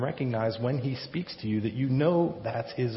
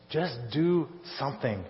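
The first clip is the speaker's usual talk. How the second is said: ordinary talk, nothing marked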